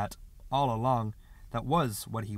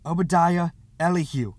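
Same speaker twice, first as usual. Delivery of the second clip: ordinary delivery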